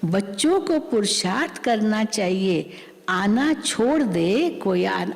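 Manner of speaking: ordinary talk, nothing marked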